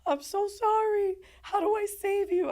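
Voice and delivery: loud, high-pitched desperate wail; re-enacted anguished cry; crying apology. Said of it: "crying. I'm like".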